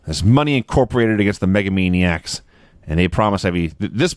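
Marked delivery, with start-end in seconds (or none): none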